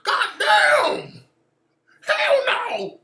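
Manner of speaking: loud, high-pitched hammed-up outburst; exaggerated shriek of alarm; mock yelling. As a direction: holler as if mocking overexcited onlookers. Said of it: "Yelling for no damn reason".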